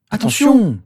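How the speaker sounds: high, loud cry of alarm; shouted warning. A narrator's reading. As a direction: yell it as something is about to fall. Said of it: "s'écrièrent-ils en plongeant tous les deux".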